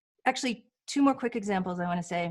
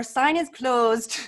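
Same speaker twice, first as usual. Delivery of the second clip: slow, lightly sing-song closed sign; playful mock announcement; 2 semitones higher, 4 dB louder, much slower than usual. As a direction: announce it teasingly, slowly.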